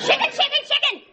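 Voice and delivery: high, rapid playground jeer; childish taunting. Acted. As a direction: chant it at him like a bratty child. Wife taunts him childishly.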